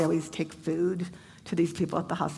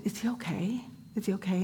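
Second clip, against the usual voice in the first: anxious whisper; 6 dB quieter than usual. Retold or imitated.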